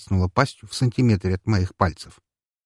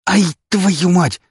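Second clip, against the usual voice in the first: pain; 11 semitones higher, 7 dB louder, much slower than usual. Acted. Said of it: "зашипел я".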